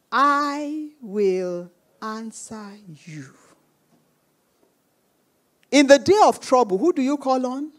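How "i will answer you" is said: very slow, lowered voice; weighty, drawn-out divine promise; solemn promise. Acted. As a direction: give it as a measured, softer assurance. God's promise quoted solemnly.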